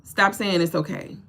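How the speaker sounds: ordinary talk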